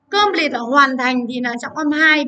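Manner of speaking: usual speaking voice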